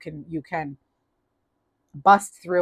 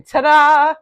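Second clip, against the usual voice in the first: triumphant ta-da; 8 semitones higher, 8 dB louder than usual. Real, not acted. Triumphant reveal of card.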